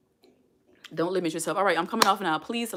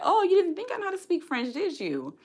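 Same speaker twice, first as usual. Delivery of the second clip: teasing gotcha; 9 semitones higher, 4 dB louder than usual. Retold or imitated.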